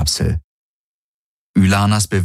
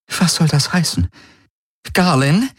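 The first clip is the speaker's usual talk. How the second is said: much higher, panicked question; rising panic at the name Garlin; alarmed woman; 10 semitones higher than usual. Acted. Prisoner alarmed at her sentence, much higher voice.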